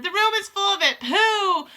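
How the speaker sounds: high, loud disgusted witches; shout. Acted. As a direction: cry out in disgust. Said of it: "they shouted".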